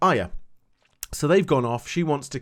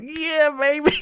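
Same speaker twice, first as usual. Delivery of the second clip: excited cheer; 12 semitones higher, 4 dB louder, slower than usual. Retold or imitated. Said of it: "I was like".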